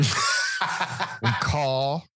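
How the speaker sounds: slow, laughing through words. Real, not acted. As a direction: laugh while saying it. Laughing at the absurdity.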